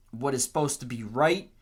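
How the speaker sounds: ordinary talk, nothing marked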